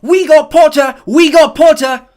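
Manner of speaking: very high, loud, slow cheering yell; twins yelling. A narrator's reading. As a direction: cheer it across the hall. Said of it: "Weasley twins yelled".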